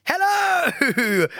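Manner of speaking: big bright high shout of hello; cheery shout. Retold or imitated. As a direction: belt out a presenter's greeting. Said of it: "you're gonna jump down and be like".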